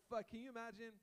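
ordinary delivery

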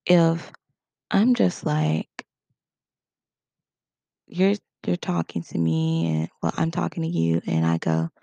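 usual speaking voice

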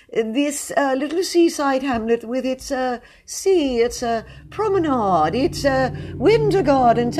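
pompous poet, pitched well up; a fussy, self-important high voice, full of ums. A narrator's reading. Mr Jones reads his spring poem to admirers, high-pitched and pompous.

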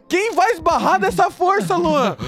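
mais agudo, alto e lento, indignado; bronca indignada. Retold or imitated. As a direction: repreendendo o amigo, incrédulo. Bronca no amigo. (bronca aguda e indignada no Luan)

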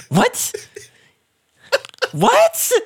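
high, rising in disbelief; can't believe what you're seeing; squeaky baffled what; incredulous. Retold or imitated. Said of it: "I was like".

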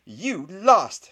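high, very loud gleeful shriek; triumphant shriek. A narrator's reading. Girl tells rival he lost their contest, shrieking. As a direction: shriek it in victory. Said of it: "shrieked Hermione".

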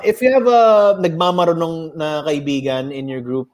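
ordinary talk

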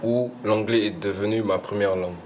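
normal talking voice

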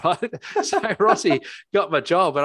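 rueful laughing repeat; laughing retelling. Real, not acted. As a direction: laugh through the retelling of the lost job. Laughing retelling of lost job.

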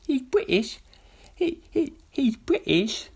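high, loud put-on British exclamation; mock British voice. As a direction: squeak it out in a pompous British voice.

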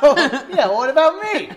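high laughing mock whine. Real, not acted. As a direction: laugh it out, pleading.

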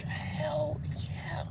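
a proud breathy whisper; whispered hell yeah. Retold or imitated. Author answering the barista who asks if he wrote it, whispered.

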